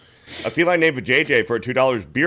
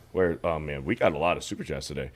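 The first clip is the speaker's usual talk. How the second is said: ordinary talk, nothing marked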